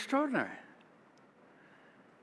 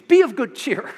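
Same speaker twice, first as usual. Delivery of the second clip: cheerful call; 6 semitones higher, 5 dB louder than usual. Acted. Jesus voiced to frightened disciples.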